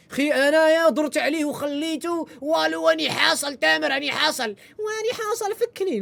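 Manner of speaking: slow, high whiny imitation of his friend; mocking the friend. Retelling his friend's call, mocking whine. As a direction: drag it out like a pleading friend. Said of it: "واش قال لي؟".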